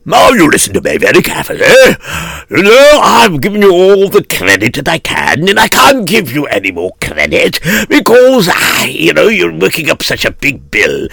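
firm businesslike creditor voice; stern creditor. Acted. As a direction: lay down the law politely. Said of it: "a creditor came in and he said".

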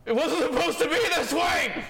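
anguished. Acted. Character grieving dead friends.

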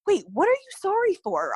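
high-pitched, bewildered questioning; retold confusion, pitched well up; confused. Patient asks the tech why she's sorry, confused. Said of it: "I was so confused".

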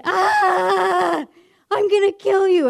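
high-pitched mock shriek of rage; retold scream. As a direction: scream it like an enraged pupil. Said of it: "screamed a scream that I have never".

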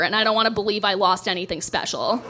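ordinary talk, nothing marked

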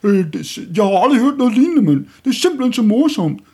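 high, loud, fast gushing "mand" voice; mocking film-crowd voice. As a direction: ham up a gushing hipster raving about a film. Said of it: "hvad man laver andet end".

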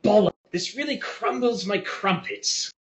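high mock posh British grumble; mock English accent. Mocking a friend losing a game, high posh grumble. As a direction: whine it like a sore British gamer. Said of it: "He's like".

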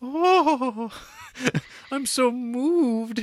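mock swoon of a heroine moved by the stunt; mock swooning woman. Mary swooning over the gesture.

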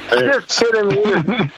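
slow, drawn-out imitation of [name]; slow mock [name]. [name] re-quotes [name], slowed right down for effect. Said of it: "he responded by saying".

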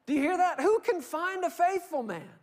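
full-force preacher's appeal; preaching. Real, not acted. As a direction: press the question home to the congregation. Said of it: "preaching at full force".

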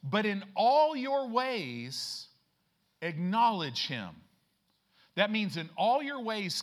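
loud, high, stretched-out preaching; full-force preaching. Acted. Preacher driving the verse home to the church, loud and slow. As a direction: preach it out to the congregation, big and slow.

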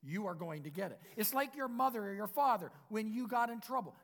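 ordinary delivery

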